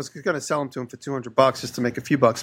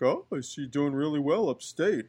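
slow, nosy neighbor gossip; lightly voiced gossiping neighbor; mock gossip; 3 dB quieter than usual. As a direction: drawl it like a prying neighbor.

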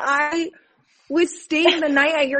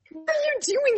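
startled question; 11 semitones higher, 8 dB quieter than usual. Retold or imitated. Confronting sleepwalker.

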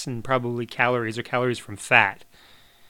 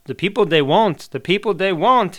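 raised, pleading retelling of a chef; mock-exasperated, pitched up on the repeat; 6 semitones higher, 5 dB louder than usual. Chef explaining why he gave in, mock exasperation. Acting out chef's exasperated quote.

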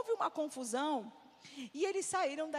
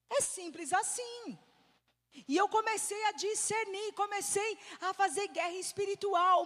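high, loud exhortation with stretched phrase endings; full-force preaching; 6 semitones higher, 8 dB louder than usual. Real, not acted. Pastor urging the new generation to take up God's kingdom, loud impassioned preaching. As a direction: preach it out, raised and ringing.

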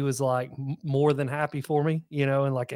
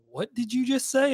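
quoting wife; 11 semitones higher than usual. Retold or imitated. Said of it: "she's like".

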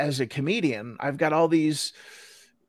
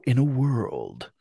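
trailer voice; 4 semitones lower, much slower than usual. Shown as a demonstration. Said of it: "I just wanna hear you go".

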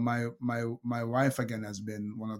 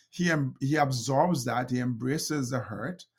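usual speaking voice

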